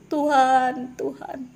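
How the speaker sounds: lamenting cry of the regretful; crying out to God. Pretend. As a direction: plead as the suffering crowd. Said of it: "banyak orang akan berseru".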